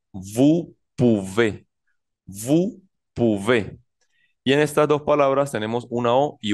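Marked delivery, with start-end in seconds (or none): 0.1-3.8 very slow "Vous pouvez" with French u sound; slow French demo. Shown as a demonstration. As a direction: stretch out "vous pouvez" slowly, ou as u. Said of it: "Entonces decimos". (pronunciación lenta de "vous pouvez")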